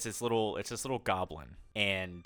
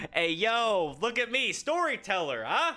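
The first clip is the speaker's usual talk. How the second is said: loud, much higher hype shout; excited cheer; 13 semitones higher, 7 dB louder, slower than usual. Real, not acted. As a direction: hype up the storyteller. Player cheers the storyteller.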